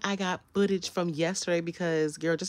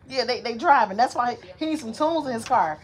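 usual speaking voice

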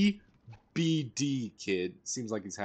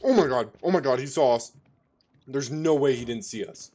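loud, high panic, he saw us; panicked excitement; 3 semitones higher, 8 dB louder than usual. Real, not acted. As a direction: blurt it out loud in real alarm. Enemy spotted them, panicking.

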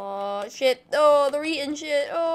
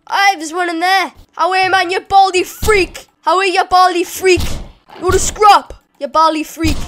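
loud, raised jeering at an enemy; taunting challenge; 5 semitones higher, 11 dB louder than usual. Pretend. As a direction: shout them into a scrap.

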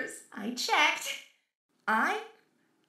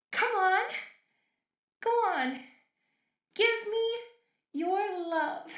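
unhinged villain; 7 semitones higher than usual. Acted. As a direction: snap into eager unhinged demand. Demanding creator return love.

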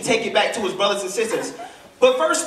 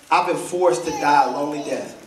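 very low, subdued voice of John; understated grief, sunk deep in pitch; weeping John's answer; 6 semitones lower than usual. Acted. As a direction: answer low and heavy, without sobbing. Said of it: "he's sobbing uncontrollably".

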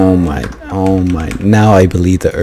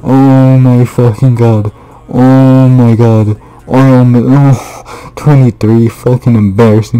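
loud, high wail of disbelief; real despairing outburst, pitched up; distraught yelling; 5 semitones higher, 6 dB louder than usual. Real, not acted. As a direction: wail in anguish over a blown run. Gamer distraught after blowing the run.

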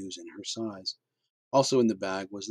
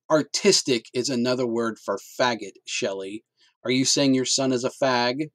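louder, sneering put-down; contemptuous interruption; 4 semitones higher, 8 dB louder than usual. Acted. Husband cutting off his wife, louder and sneering. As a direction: cut her off with scorn. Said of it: "Waylon cut her off".

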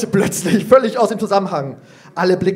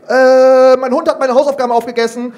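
put-on louder, higher, fast kid's blurt; child calling out; 6 semitones higher, 7 dB louder than usual. Finja calls out her excuse to the teacher, loud and fast. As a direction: shout the excuse out eagerly. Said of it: "ruft Finja".

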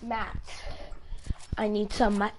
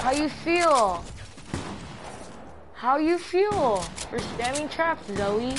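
taunting; 3 semitones higher, slower than usual. Real, not acted. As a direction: gloat over the beaten player. Taunting downed enemy.

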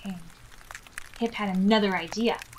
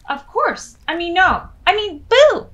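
flustered boo; 9 semitones higher, 14 dB louder than usual. Acted. Ghost flustered then booing.

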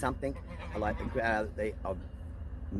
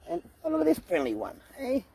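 much higher, sing-song pet talk; cooing at a goat; 12 semitones higher than usual. Real, not acted. Vlogger cooing to a friendly goat, high sing-song.

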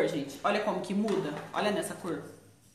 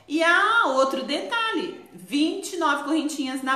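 slow, higher and louder through laughter; laughing; 7 semitones higher, 6 dB louder than usual. Real, not acted. Host showing another collar detail while laughing. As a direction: say it while cracking up.

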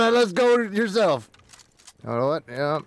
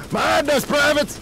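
shouted command; 8 semitones higher than usual. Acted. Sergeant calling out to squad.

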